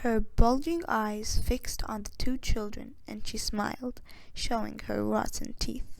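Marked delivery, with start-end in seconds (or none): none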